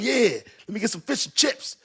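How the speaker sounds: high, excited imitation; hammed-up high-pitched enthusiasm; eager retold order. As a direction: act out an eager order at the truck. Replaying his own eager order.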